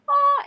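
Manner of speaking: high, loud "ah" of delight; delighted gasp. Retold or imitated. Re-enacting delight at a neighbor's kind greeting, high squeal. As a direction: squeal a short, touched "ah".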